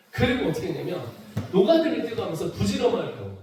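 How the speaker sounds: normal talking voice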